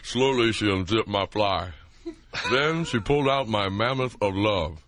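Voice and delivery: Allstate guy voice. Retold or imitated. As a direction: read it like a solemn insurance spokesman. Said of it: "Here is the Allstate guy".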